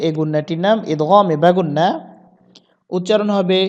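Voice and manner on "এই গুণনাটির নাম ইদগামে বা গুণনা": ordinary talk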